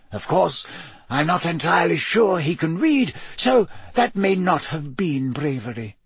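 put-on lightly amused elderly wizard; Dumbledore voice. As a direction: play the wise old headmaster, gently teasing.

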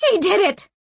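loud, high accusing shriek. A narrator's reading. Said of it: "shrieked Sanchia".

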